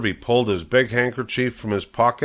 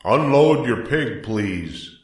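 loudspeaker announcer; 3 semitones higher, slower than usual. A narrator's reading. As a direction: call it out slowly over the fairground. Loudspeaker announcer gives instruction.

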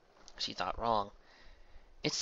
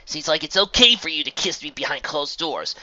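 bitter accusation; 6 semitones higher, 10 dB louder than usual. A narrator's reading. Lovers' quarrel, bitter accusation.

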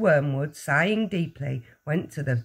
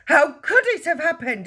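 high, loud baffled yell; 12 semitones higher, 6 dB louder than usual. A narrator's reading. Father asks the whole family, yelling. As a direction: yell the question in dismay. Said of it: "he yelled".

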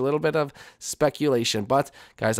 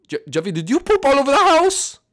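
higher, louder accusing question; scolding parent; 12 semitones higher, 6 dB louder than usual. Acted. Parent confronts Jeffy about pooping, scolding. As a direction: confront the kid about the mess.

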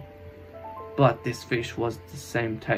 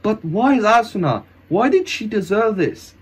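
high, anguished questioning; anguished plea; 7 semitones higher than usual. Acted. Hero asking why Asuna suffers, anguished. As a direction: protest in anguish, pitched high.

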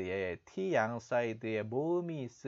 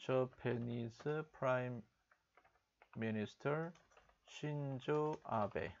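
"Japanese Prime Minister Shinzo Abe" spoken slowly and a little softer; slow soft repeat; 6 dB quieter, much slower than usual. Shown as a demonstration. Teacher slowly repeating the anchor's line for the class. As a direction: drop the volume and take it word by word. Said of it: "repeating the news line slowly".

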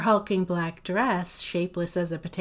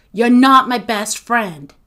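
loud, gruff denial; gruff retort; 3 semitones higher, 11 dB louder than usual. A narrator's reading. Agatha rejects Sophie through the door, gruff and loud. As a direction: push back hard and gruffly. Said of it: "came the gruff voice".

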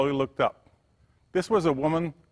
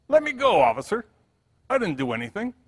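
high, louder plea to be let go; a narrated panicky protest; 9 semitones higher, 5 dB louder than usual. A narrator's reading. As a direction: homeless man pleading with the officer, higher and louder. Homeless man resists being lifted.

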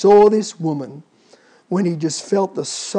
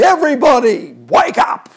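louder, higher rousing call; trumpet call; 5 semitones higher, 7 dB louder than usual. Retold or imitated. Jesus at the last trumpet waking the dead, loudly. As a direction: wake the whole world. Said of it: "He'll say".

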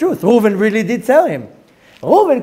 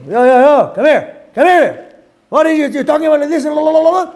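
high, loud acted confrontation; mock screaming; 8 semitones higher, 4 dB louder than usual. Shimon screaming at Levi in the street, mock yelling. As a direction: holler at him in mock outrage, pitched well up. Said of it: "starts screaming at him".

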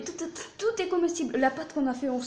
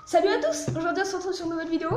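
youtuber intro; 4 semitones higher, faster than usual. Acted.

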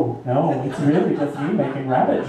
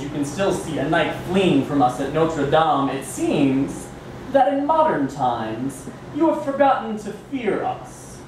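Bunnicula; 4 semitones higher than usual. Acted. Villain rabbit persona poem.

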